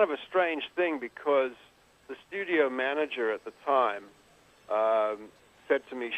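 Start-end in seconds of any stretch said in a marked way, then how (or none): none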